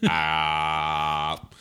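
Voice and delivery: ordinary talk, nothing marked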